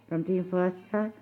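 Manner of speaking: usual speaking voice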